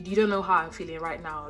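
usual speaking voice

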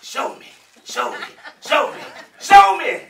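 mock the crowd's eager demand; imitating eager audience. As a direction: chant rising to loud. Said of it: "Y'all looking at me like".

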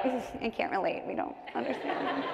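soft, aloof little mock voice; imitating smug Canadians. Canadians unable to relate to American healthcare, softly mocking. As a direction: demur quietly, as if politely baffled. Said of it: "You Canadians over here are like".

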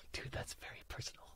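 hushed, nearly whispered protest; taken aback. Real, not acted. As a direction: sigh it out under your breath. Caught by question about lost love.

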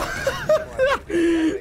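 high, loud burst of disbelieving laughter. Real, not acted. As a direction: laugh out in shock at the play. Enemy planted, shocked laugh.

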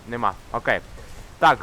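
ordinary talk, nothing marked

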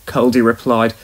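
usual speaking voice

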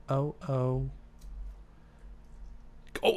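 ordinary talk

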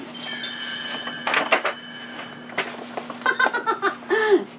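high breathy piggy laugh. A narrator's reading. First pig laughing at his hard-working brother, high mocking laugh. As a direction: giggle like a mocking little pig. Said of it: "Laughed the first little pig".

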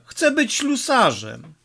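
put-on high-pitched, earnest boy's wish; lightly voiced boy insisting on his dream; boyish declaration. A narrator's reading. (wysoki, chłopięcy głos, z przekonaniem)

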